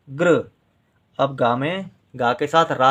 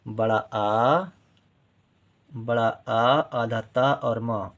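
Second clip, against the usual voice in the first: drawn-out vowel; much slower than usual. Shown as a demonstration. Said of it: "teacher sounds out the long vowel".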